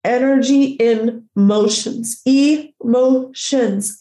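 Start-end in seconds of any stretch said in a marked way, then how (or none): 2.3-4.0 "Emotions" very slow, split into three parts; drawn-out syllables. Shown as a demonstration. Speaker explaining energy in motion, "Emotions" slowly broken up.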